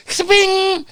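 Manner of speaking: a very high, loud squeak; cartoon zip sound. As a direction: make the cartoon noise of bolting off.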